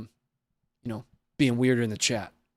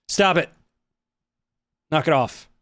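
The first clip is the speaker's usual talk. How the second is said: firm loud scold; 3 semitones higher, 4 dB louder than usual. Real, not acted. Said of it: "scolding chat, repeated louder".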